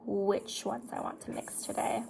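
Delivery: whispering to herself while picking a shade. Real, not acted. Talking to herself over the palette, whispered. Muttering to self choosing eyeshadow.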